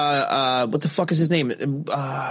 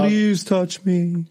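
slow mock-sultry ghost; much slower than usual.

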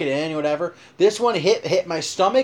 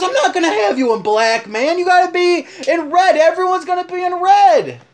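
imitating the buddy flipping out, much higher and louder; furious yell; 9 dB louder than usual. Buddy yelling at the tuxedo shop staff in fury. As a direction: yell it like a mellow guy who finally snapped. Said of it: "He flips out".

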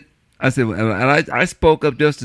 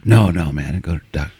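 lower, slacker buddy voice, fading off; buddy's voice; 11 semitones lower, faster than usual. Retold or imitated. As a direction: drop low and shrug it off. Retells buddy's phone call.

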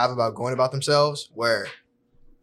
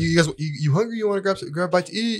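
higher, eager bro invitation; chummy buddy pitched up high; frat bro; 5 semitones higher than usual. Retold or imitated. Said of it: "They'll be like".

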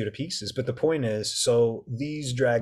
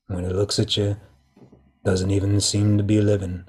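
Quint from Jaws; 4 semitones lower than usual. Retold or imitated. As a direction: brood over the shark like an old sailor. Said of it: "you remember Quint from Jaws".

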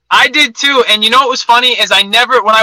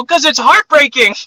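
higher, drawn-out mock exasperation; emphatic retort; 6 semitones higher than usual. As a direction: stretch it out as an obvious answer. Acted emphatic reply to girlfriend.

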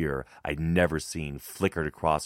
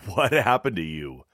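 higher-pitched, mildly teasing question; lightly jokey, slightly on edge; uneasy banter; 4 semitones higher, 4 dB louder than usual. A narrator's reading. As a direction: cover a touch of unease with banter. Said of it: "he choked like always to hide his unease".

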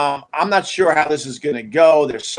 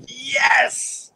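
high, raised-pitch "yes" of relief; high-pitched celebratory exclamation; mock relieved cheer; 10 semitones higher, 3 dB quieter than usual. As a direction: act out a little victory cry. Relieved reaction to checking the roof.

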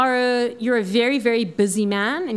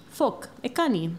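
ordinary delivery

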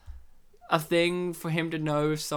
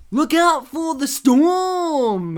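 slow, loud, high odd warning cry; weird storm warning; 8 semitones higher, 9 dB louder, much slower than usual. Retold or imitated. Character warning the hero about the storm, loud and odd. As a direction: call it out slow and strange like a crank. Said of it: "in the weirdest manner".

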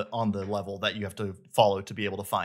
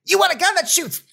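manic robot pitchman, very high and loud; high-pitched robotic voice; 8 dB louder than usual. Acted. Game character selling a new weapon, high-pitched shouty robot voice. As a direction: shout it like a hyped-up used car salesman robot. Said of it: "robotic, high-pitched voice".

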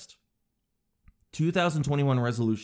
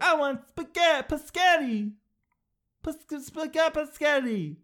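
high-pitched mock toddler; little kid answering; 14 semitones higher than usual. Kid answering an adult about dinner, high babyish voice. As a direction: squeal it way up high, stumbling over the word. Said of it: "And then the kid answers back".

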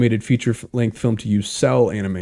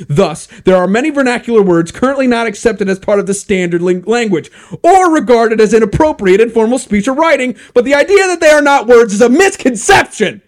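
reading higher and louder into a rant; building rant; 10 semitones higher, 7 dB louder than usual. Acted. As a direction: work yourself up, ever higher and louder. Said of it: "Are you okay?".

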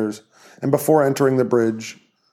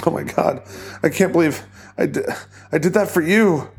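blubbering; 6 semitones higher than usual. Acted. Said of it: "Quit blubbering".

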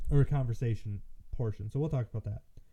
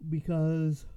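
word stretched through a real yawn; 5 semitones higher, 4 dB louder than usual. Real, not acted. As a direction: say it mid-yawn, mouth wide open. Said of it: "I'm yawning and I shouldn't be".

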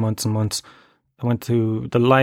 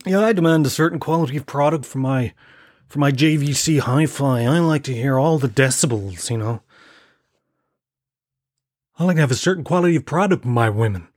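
lightly voiced radio host; 2 semitones higher than usual. Host boasting about his hi-fi and women, lightly smug voice. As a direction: savour each phrase like a fussy hi-fi buff. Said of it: "Like he's talking about a".

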